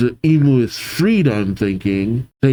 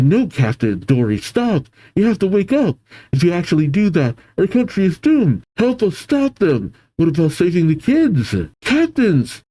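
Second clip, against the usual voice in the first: urgent, high-pitched begging; desperate pleading; 6 semitones higher than usual. Acted. Giants begging possessed captains, desperate high plea.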